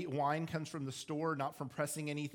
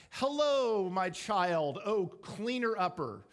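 loud, high mock-grand hail; sarcastically proclaimed title, drawn out at the end; sarcastic greeting; 4 semitones higher, 5 dB louder, slower than usual. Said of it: "I'm being sarcastic when I'm doing that".

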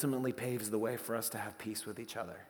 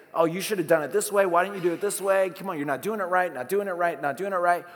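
louder, higher, fast mock nagging; hammed-up critic who nags everyone; nagging jerk; 5 semitones higher, 7 dB louder, faster than usual. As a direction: rattle off nitpicks like a bossy jerk. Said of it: "jerks look at everybody else and say".

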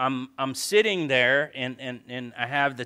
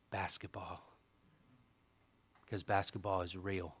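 softer, a touch of divine calling; God's gentle voice; 3 semitones lower, 7 dB quieter than usual. Retold or imitated. God asking the pastor to come worship, softly. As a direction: gently reproach as God would.

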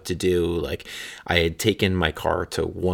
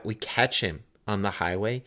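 normal talking voice

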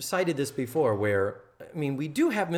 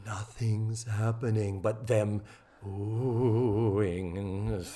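slow monotone, stretching the last word; drawn-out; 4 semitones lower, much slower than usual. Pretend. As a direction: drag it out like an endless pause. Mimics a drawn-out empty pause.

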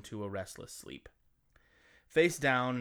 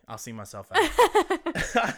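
laughing; 12 semitones higher, 11 dB louder, much slower than usual. Real, not acted. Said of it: "I'm sorry".

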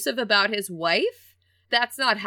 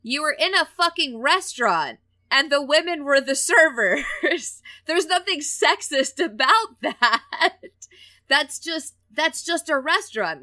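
higher, louder exasperated comeback with laughs; a pretend retort, higher and louder, breaking into laughs; imagined angry retort; 7 semitones higher, 3 dB louder than usual. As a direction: tell him off, laughing in disbelief. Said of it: "and say".

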